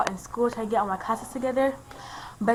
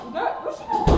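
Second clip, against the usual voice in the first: squeaky, much higher mock voice; 5 dB louder, faster than usual. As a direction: pitch way up, teasing. Mock quote, squeaky high voice.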